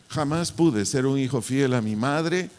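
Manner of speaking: ordinary talk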